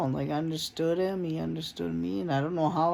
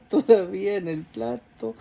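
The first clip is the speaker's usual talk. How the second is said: quoting through a laugh; 4 dB louder than usual. Retold or imitated. Retelling the priest's repeated line, laughing. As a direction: let the laugh break into the words. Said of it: "I even laughed".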